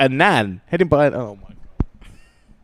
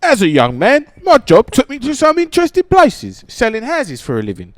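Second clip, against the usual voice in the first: high, loud plummy advert read; hammed-up posh voice; 11 semitones higher, 6 dB louder than usual. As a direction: overdo the posh TV announcer. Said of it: "hate that voice".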